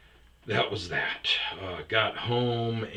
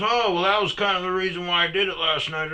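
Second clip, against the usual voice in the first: higher, louder sheepish excuse; mock sheepish coworker; whiny excuse voice; 11 semitones higher, 10 dB louder, faster than usual. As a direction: play the guilty buddy making excuses.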